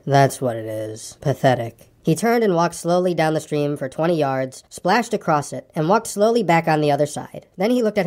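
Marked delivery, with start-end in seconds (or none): 0.1-1.9 low, slow, dejected donkey; gloomy Eeyore. Acted. Eeyore regards his reflection, low and gloomy. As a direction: drag the words out low and mournful.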